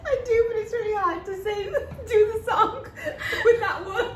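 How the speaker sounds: laughing. Real, not acted. Contestant defending a failed attempt, laughing. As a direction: protest through the giggles. Answers while cracking up.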